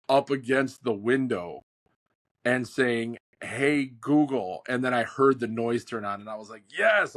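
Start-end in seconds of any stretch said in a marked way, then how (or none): 3.4-4.6 drawn-out wake word, face to the glass; slowly says Hey, Google through a window; slow loud call to the truck. Retold or imitated. Man outside his truck calling the car assistant, slow and drawn out. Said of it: "picture me pressing my face". 6.7-7.2 high, loud burst of joy; ecstatic yes. Retold or imitated. Man hearing his car assistant wake up, ecstatic cheer. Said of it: "I was ecstatic".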